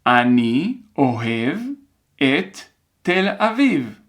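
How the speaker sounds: very deliberate dictation, pausing after each word or two; careful, spaced-out classroom dictation. Shown as a demonstration. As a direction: dictate word by word for learners to write. Teacher repeats sentence for dictation.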